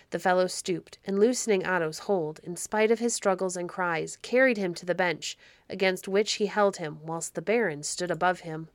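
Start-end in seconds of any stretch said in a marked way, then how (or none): none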